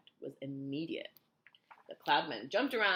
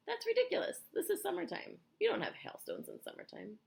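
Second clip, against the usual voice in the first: higher, scornful scoff. A narrator's reading. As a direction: dismiss it with contempt. Centipede scoffing loudly.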